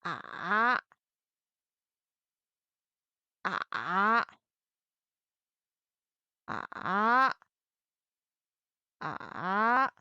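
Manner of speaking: "Ah" dipping into a creak, then rising; falling-rising tone. Shown as a demonstration. Teacher drilling third tone on final ah, dipping "Ah". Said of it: "put third tone on final ah".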